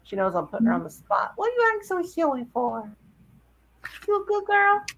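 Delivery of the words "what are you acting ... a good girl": much higher sweet coaxing voice. Real, not acted. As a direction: coo at the puppy. Baby talk to dog.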